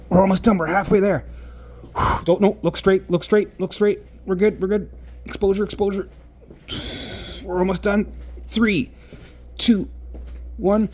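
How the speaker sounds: real nervous countdown to the end; anxious self-talk. Real, not acted. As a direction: push yourself through the fear. Talking himself through frightening exposure.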